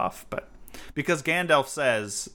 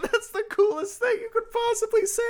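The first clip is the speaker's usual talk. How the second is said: much higher, starry-eyed gush over the line; gushing fan. Retold or imitated. Fan retelling her own gushing reaction to the line. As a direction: swoon like a thrilled fan. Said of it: "And I'm like".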